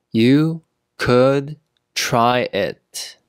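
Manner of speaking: one word at a time, slowly; slow teaching pace. Shown as a demonstration. Teacher modelling "try it" for learners, word by word. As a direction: leave a clear gap after each word.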